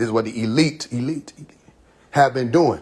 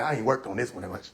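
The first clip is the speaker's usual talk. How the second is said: muttered aside; 5 semitones lower, 10 dB quieter than usual. Retold or imitated. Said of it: "I'm like".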